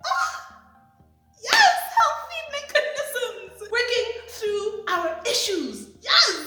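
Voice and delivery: high, loud, slow excited squeal; squealing delight. Retold or imitated. Fan squealing with joy over healthy conflict resolution. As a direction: gush with overjoyed glee. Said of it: "I was like".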